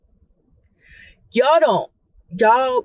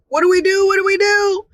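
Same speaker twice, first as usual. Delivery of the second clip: panicked; 8 semitones higher, faster than usual. Retold or imitated.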